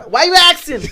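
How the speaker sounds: high, loud mocking echo; mock Jacoby. Repeats Jacoby quote laughing.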